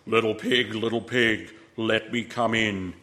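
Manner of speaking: the big bad wolf calling; deep wolf voice. A narrator's reading. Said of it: "they heard a deep voice".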